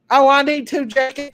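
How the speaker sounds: normal talking voice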